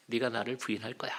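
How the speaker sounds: soft, low, soothing retold voice; gentle, reassuring. Jesus comforting Peter about his coming denial, gently. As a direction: say it kindly, as if comforting him. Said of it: "오히려 다독이고 웃으시면서".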